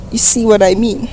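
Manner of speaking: usual speaking voice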